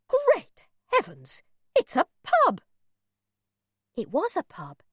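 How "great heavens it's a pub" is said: shocked exclamation. A narrator's reading. Mother shocked at pub.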